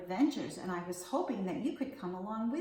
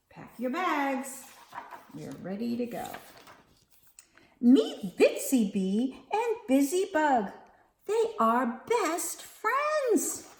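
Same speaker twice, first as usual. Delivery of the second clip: lively, sing-song picture book reading; reading to children; 8 semitones higher, 9 dB louder, slower than usual. A narrator's reading. Teacher reading Bugs at the Beach to kids, sing-song. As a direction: read it like story time.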